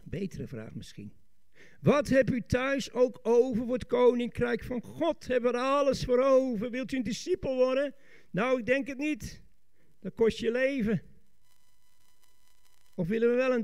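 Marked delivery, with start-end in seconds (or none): 1.8-11.0 raised, pressing preaching voice; challenging preacher. Real, not acted. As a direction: press the congregation with higher-pitched questions. Challenges the congregation. (uitdagend prekend)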